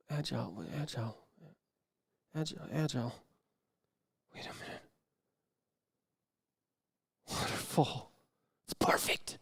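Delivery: dropping to a hush as the idea lands; hushed realization. Acted. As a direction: muse it quietly as it dawns on you. Hushed dawning idea about waterfall.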